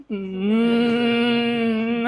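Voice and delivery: ordinary talk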